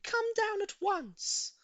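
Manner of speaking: very high, slow, sing-song woman; high eager lady. A narrator's reading. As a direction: call him down with glee. Voices Missus Silver.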